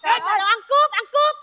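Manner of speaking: high, loud yelling at the husband; shrill yell. Acted. Wife yells at the husband during a shouting match, high and loud. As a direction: scream the insult back at him.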